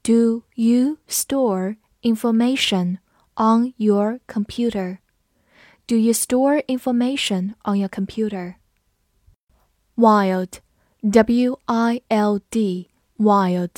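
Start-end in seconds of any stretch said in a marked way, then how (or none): 0.0-5.0 slow, each word separated; slow teacher model reading. Shown as a demonstration. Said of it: "跟着我慢读一遍". 11.0-12.9 W I L D spelled slowly letter by letter; slow spelling of the word wild. Shown as a demonstration. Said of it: "字母I在这里发它本身的音I".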